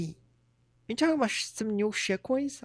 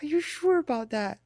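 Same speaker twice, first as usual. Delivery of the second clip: higher, pointed disbelief on sure; incredulous; 7 semitones higher than usual. Retold or imitated. Questioning a friend's dream of moving to Japan, incredulous. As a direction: push the doubt up high, teasing. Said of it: "I was like".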